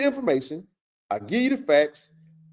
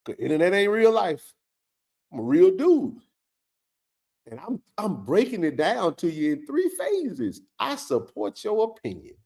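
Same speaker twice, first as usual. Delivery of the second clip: usual speaking voice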